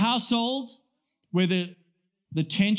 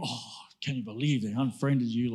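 low-pitched, hammed-up disbelief; mock dismay; 8 semitones lower, 4 dB quieter than usual. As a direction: feign being appalled at a petty slight. Mock outrage at being unfriended.

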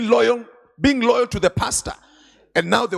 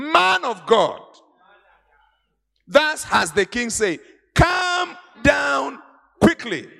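commanding captain; 6 semitones higher, slower than usual. Acted. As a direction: bark the king's order. Captain's demand acted out.

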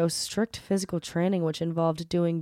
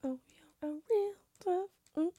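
whispering; 10 semitones higher, 9 dB quieter than usual. Pretend. Teasing friend picking trivia.

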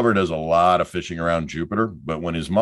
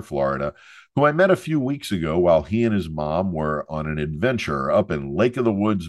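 normal talking voice

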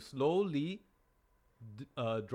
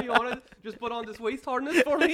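laughing; 12 semitones higher, 10 dB louder than usual. Real, not acted. Teasing offer, laughing.

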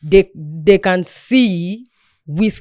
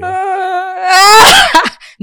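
high squealing laugh burst; shrieking laugh; 13 semitones higher, 8 dB louder than usual. Real, not acted. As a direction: let out a loud shrieking laugh.

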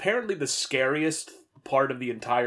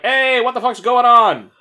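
loud, higher startled yell; startled shout; 10 semitones higher, 11 dB louder than usual. Retold or imitated. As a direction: yell it as a frightened beast. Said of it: "to be like".

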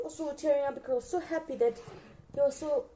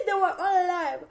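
high, loud, laughing delight; gleeful, laughter-filled exclamation; overjoyed laughing; 7 semitones higher, 6 dB louder than usual. Real, not acted. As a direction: burst out laughing with relief. Overjoyed that the characters survived.

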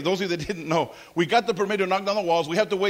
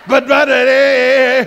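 louder, raised call for attention; 3 semitones higher, 10 dB louder, slower than usual. Real, not acted. Pastor reining in the young people, loud call. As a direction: call them back before they get ideas.

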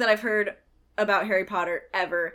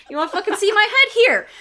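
higher, louder, fast angry retort; ghost snapping back; 11 semitones higher, 8 dB louder, faster than usual. Acted. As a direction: throw it at the cheeky kids.

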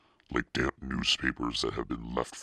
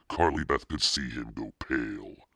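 plain reading voice

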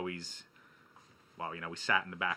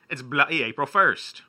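loud, slow mock-British accent; higher, louder put-on British accent; 6 semitones higher, 9 dB louder, slower than usual. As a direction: declare it in a hammed-up British accent. Clowning with the bloody accent.

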